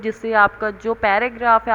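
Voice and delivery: usual speaking voice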